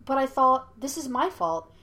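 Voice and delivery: voiced as an upset interruption; upset objection. A narrator's reading. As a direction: object with the pitch jumping up. Yue objects, taking the blame.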